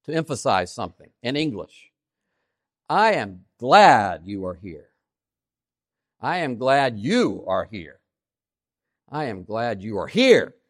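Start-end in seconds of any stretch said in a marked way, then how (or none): 2.9-4.9 "glad" punched louder and drawn out; slow sentence, leaning hard on "glad"; stress on GLAD. Shown as a demonstration. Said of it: "give it a vocal stress". 6.2-8.0 slow sentence, leaning on "you"; single out "you" with a strong pitch peak; stress on YOU. Shown as a demonstration. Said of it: "give it a vocal stress". 9.1-10.6 "here" jumps up at the end, then falls; stress on HERE. Shown as a demonstration. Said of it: "give it a vocal stress".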